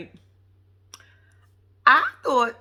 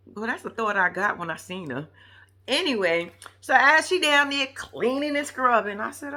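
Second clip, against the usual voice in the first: normal talking voice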